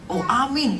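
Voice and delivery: joyful cry. Retold or imitated. Wife hearing good news by phone, imitated glad cry. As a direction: imitate an elated wife crying out.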